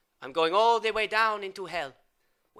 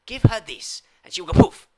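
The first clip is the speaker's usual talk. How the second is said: shouted at Luigi; 4 semitones higher, 11 dB louder than usual. Retold or imitated. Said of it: "I say".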